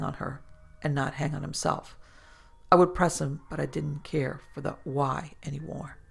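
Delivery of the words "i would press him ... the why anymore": ordinary delivery, nothing marked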